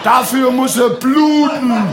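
loud, high, slow fanatic roar; hammed-up menace; slow shouted fanatic decree; mock raging shout. Voice demands killing.

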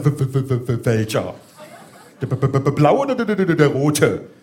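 repeated stutter on each first sound; stuttering panic over which wire; stammering bomb expert. Acted. Nervous bomb man asks which wire, stuttering. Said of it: "gestottert hat er".

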